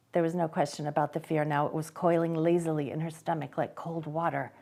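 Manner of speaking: usual speaking voice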